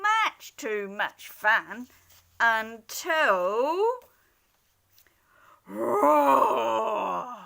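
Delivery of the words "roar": big loud bear roar. Acted. Bear roaring at the noisy animals.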